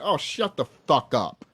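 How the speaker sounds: ordinary talk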